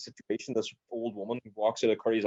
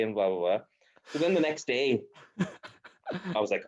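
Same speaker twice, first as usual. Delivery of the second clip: ordinary talk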